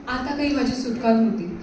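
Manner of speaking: plain reading voice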